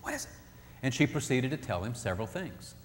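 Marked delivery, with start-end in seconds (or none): 0.0-0.3 high-pitched urgent whisper; husband's hushed high plea to wife. Retold or imitated. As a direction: hiss it high and hushed, pleading. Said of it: "in a high whisper".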